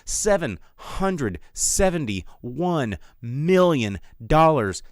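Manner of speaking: ordinary delivery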